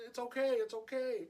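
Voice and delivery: high, mock-tearful soothing; retold crying. Comforting the crying sister, high tearful voice. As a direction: console her in an imitated weepy voice. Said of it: "and I was crying".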